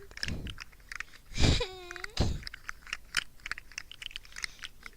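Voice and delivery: long, breathy laugh; exasperated laugh. Real, not acted. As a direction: laugh helplessly at the mishap. Laughs at failed setup.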